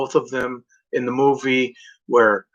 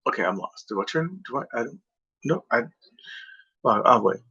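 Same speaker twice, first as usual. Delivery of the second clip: softer, confused mumble; confused driver; 7 dB quieter than usual. Retold or imitated. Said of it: "they were like".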